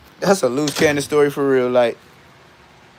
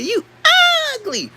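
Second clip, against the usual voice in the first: high-pitched mocking call; say it just like that; 3 dB louder than usual. Shown as a demonstration. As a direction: squeal it high, then let it drop. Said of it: "say it just like that".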